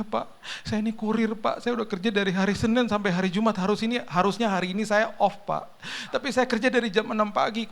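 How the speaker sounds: high, whining mock crying; imitated tearful pleading. Exhausted courier apologising to the car owner, tearful pleading. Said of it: "Tiba-tiba dia nangis".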